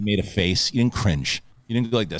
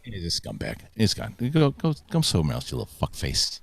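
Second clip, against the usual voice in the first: low, growled mock insult; low taunting insult to a chat troll; mock villain insult; 9 semitones lower, 8 dB quieter than usual.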